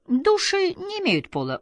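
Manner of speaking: usual speaking voice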